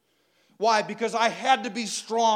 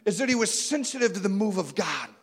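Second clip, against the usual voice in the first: normal talking voice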